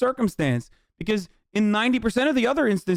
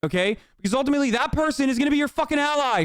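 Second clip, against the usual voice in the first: ordinary delivery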